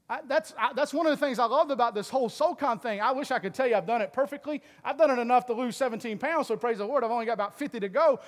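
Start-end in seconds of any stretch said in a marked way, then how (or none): none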